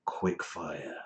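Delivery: low, quiet dramatic title drop; deep announcer voice. Acted. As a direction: growl the segment name like a trailer.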